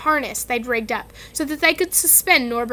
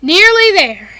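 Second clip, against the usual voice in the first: louder, out of breath from the stairs; panting; 6 semitones higher, 11 dB louder than usual. A narrator's reading. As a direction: gasp it out while hauling. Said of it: "Harry panted".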